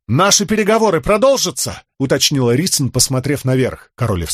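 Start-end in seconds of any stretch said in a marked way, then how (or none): 0.1-1.8 выкрик вверх. A narrator's reading. Рисн кричит вверх.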